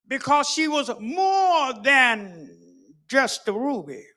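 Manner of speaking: loud, high preaching at full force. Acted. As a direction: lift the church with it, building. Preaching the homecoming.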